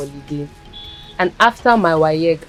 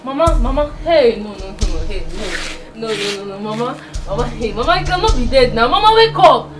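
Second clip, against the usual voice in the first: panicked pleading; 10 semitones higher than usual. Acted. Girl begging her dead grandma to wake up, frantic.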